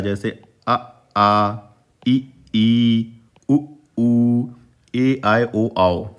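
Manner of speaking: अ short then आ held longer, each pair contrasted; short and long vowel pairs. Shown as a demonstration. Teacher contrasting short and long Hindi vowels aloud, the long ones held. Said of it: "बड़ा आ बोलने में थोड़ा सा ज़्यादा समय".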